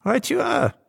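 put-on very high little old devil voice; chirpy Old Nick. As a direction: agree briskly in a high old man's pitch.

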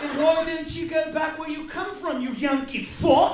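hammed-up waiter telling them off; comic waiter yelling at the tourists; mock angry waiter. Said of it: "And the waiter was like".

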